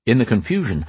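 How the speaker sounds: ordinary delivery, nothing marked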